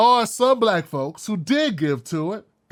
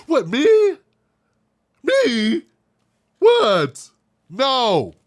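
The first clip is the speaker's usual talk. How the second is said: loud, higher mock "What, me?" of feigned shock; sarcastic feigned innocence; mock innocent surprise; 3 semitones higher, 4 dB louder, much slower than usual. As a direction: play the offended innocent, overdone. Mocking someone playing innocent.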